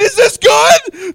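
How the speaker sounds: slow, drawn-out shout; long shouted mic check; yelling. Shown as a demonstration. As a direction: yell the question, stretching each word. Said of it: "Start yelling".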